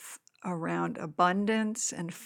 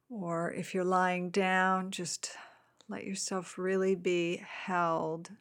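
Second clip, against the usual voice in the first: guided meditation. Acted. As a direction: gently guide them into the body.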